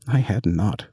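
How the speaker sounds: usual speaking voice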